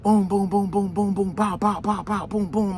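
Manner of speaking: rapid high-pitched booms and pows; vocal volley of gunshots, fired off evenly; imitated gunfire. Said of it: "all you heard".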